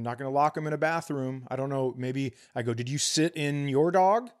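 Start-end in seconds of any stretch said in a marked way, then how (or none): none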